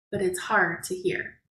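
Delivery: usual speaking voice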